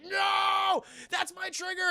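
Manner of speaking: high sudden mock outburst; mock angry cry. Peter's angry reply, acted.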